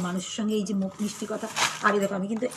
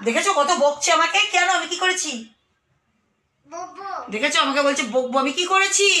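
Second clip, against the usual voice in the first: high, louder pretend indignation; mock hurt; 6 semitones higher, 8 dB louder than usual. Mother pretending to be scolded by her toddler, mock hurt and high. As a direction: play offended for the child.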